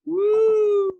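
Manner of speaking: loud high whoop; excited whoop. Real, not acted. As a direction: let out a big cheer. Celebrating loudly.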